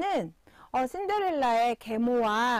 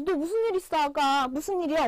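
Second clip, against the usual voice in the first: a light touch of a worried mother, higher; worried mom; 5 semitones higher, 3 dB louder than usual. Retold or imitated. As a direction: ask gently like a concerned mom. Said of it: "엄마가 물어봤어요". (걱정하는 엄마 목소리를 살짝 흉내)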